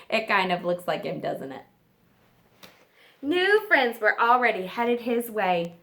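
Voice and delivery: usual speaking voice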